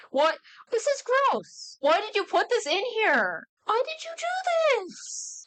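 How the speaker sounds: high, slow, squeaky disgust; grossed-out wail at the writer; disgusted outburst. Real, not acted. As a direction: recoil in horror at the page. Disgusted reaction to underage subplot.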